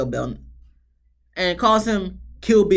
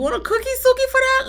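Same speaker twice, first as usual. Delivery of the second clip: much higher mock praise, dripping sarcasm; sarcastic high jab; 4 dB louder, faster than usual. Real, not acted. Recapper mocking Suki with high sarcasm.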